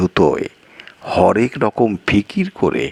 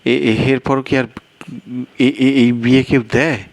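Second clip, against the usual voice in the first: higher, stuttering in shock; the guest stumbles over his words; stammering guest; 7 semitones higher than usual. Acted.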